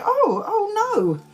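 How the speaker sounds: high startled panic; sudden alarm at the towel; high-pitched mock panic. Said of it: "And I'm like".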